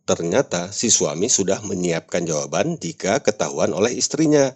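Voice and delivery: normal talking voice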